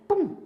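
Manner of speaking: a sudden high pum, like a magnet snapping shut; playful snap noise; mock pop sound.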